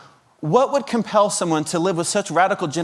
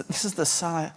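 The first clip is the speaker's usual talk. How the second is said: ordinary talk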